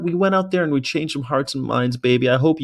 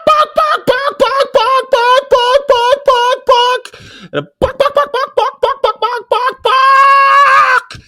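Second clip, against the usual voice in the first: very high, loud barking yelps; barking horn imitation; 9 dB louder, much slower than usual. Imitating the chicken truck's horn outside Chick-fil-A, high loud barks. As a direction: bark like the truck's dog horn.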